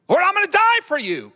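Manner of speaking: eager Peter. Acted. Peter boasting to Jesus.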